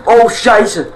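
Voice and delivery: high, loud German curse; startled curse. Real, not acted. Gamer gets caught out and curses in German, startled. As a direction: blurt it out in shock. Sudden shock in game.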